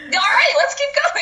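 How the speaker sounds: high, excited go-again cry; gleefully rally everyone onward; hammed-up keenness; mock eager rallying. Voicing eager friends who never stop.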